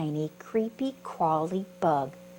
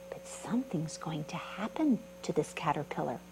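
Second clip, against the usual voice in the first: suspense whisper; 3 semitones lower, 5 dB quieter than usual. Shown as a demonstration. Teasing suspense for kids.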